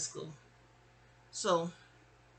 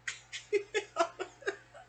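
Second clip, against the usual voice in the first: high, breathy burst of laughing; real laughter. Real, not acted. Storyteller recalling being in trouble with her mother, laughing. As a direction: laugh helplessly at your own memory.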